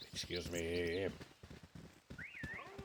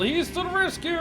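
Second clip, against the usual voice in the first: much higher and louder, thrilled; excited cheer; 13 semitones higher, 5 dB louder than usual. Real, not acted. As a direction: cheer him on. Cheering NPC arrival.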